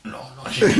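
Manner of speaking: laughs loudly, unable to finish the sentence; a real, hearty laugh breaking in after the first words; burst of laughter. Real, not acted. Laughing off the interviewer's teasing question, loud real laughter.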